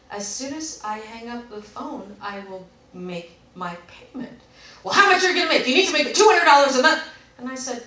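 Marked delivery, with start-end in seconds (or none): 0.1-4.4 slow, soft, measured delivery; very calm. Retold or imitated. Customer answering a pushy rep, very calmly. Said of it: "I just very calmly said". 4.8-7.0 loud, high, relentless pressing; demanding imitation. Rep demanding monthly amount from caller, demanding.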